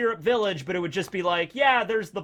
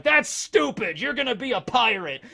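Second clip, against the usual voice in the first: higher, louder scoffing dad; gruff drunk dad; 5 semitones higher, 4 dB louder than usual. Retold or imitated. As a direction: dismiss it like a drunk father. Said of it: "he's just like".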